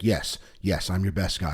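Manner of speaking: usual speaking voice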